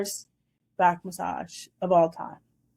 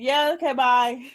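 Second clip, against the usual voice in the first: hasty brush-off; 5 semitones higher, 4 dB louder than usual. Retold or imitated. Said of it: "I was like".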